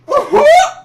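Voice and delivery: loud high laugh breaking out; loud amused laugh, high-pitched; high burst of laughter. Real, not acted. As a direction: cackle at your own imitation. Laughs after the mocking quote.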